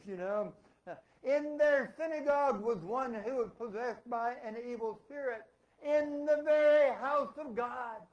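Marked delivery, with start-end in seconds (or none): 1.2-8.0 loud, high proclamation, stretched at the end; forceful preaching. Real, not acted. Preacher telling the church a spirit was in God's house, loud proclamation. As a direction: thunder it out to the whole church.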